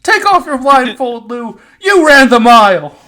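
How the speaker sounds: high, loud, slow coach voice with laughter; laughing gym coach. Retold or imitated. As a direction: shout praise like an overexcited coach.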